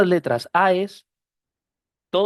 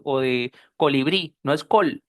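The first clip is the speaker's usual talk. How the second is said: usual speaking voice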